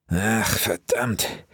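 slow, drawn-out grumbled curse; weary curse. A narrator's reading. Wilson cursing as he heaves himself up. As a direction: groan the swear in frustration. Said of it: "fluchte Wilson".

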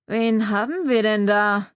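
slow, mumbled, mouth barely open; sheriff mumbling. A narrator's reading. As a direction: squeeze the words out through a half-closed mouth. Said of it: "knautschte der Sheriff". (Sheriff, kaum geöffneter Mund, langsam genuschelt)

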